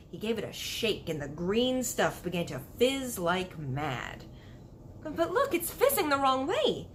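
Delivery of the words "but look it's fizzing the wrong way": high, astonished exclamation; surprised cry. Acted. Sophie seeing bubbles sink, high astonished cry. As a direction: point at it in amazement. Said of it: "Sophie cried".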